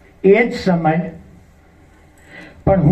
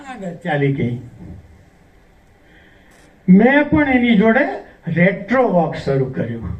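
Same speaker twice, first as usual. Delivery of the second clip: ordinary delivery, nothing marked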